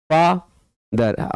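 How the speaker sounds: "father" with a long gap after fa; split into syllables. Shown as a demonstration. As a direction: say "father" as two isolated beats, fa, ther. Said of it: "यहाँ पर दो syllable हो गए".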